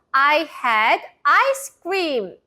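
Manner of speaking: very slow, clearly spaced sentence; slow model sentence. Shown as a demonstration. Teacher modelling the sentence for kids to repeat aloud. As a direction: say it slowly for repeating. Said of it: "큰 소리로 따라해 보세요".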